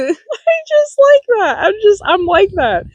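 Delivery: laughing through the words, high; giggling as she admits it. Real, not acted. Telling a friend about herself, laughing. Laughing at her own habit.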